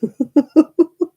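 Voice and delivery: bright high-pitched laugh. Real, not acted. Laughing at own remark.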